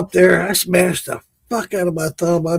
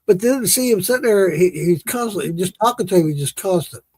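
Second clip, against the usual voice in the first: normal talking voice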